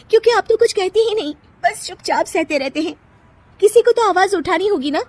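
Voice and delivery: high, fast, fired-up protest; indignant wife. Acted. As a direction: rouse the silent husband. Nisha angry at husband. (पति पर भड़की पत्नी)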